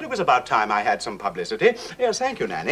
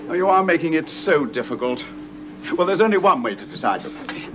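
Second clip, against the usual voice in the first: normal talking voice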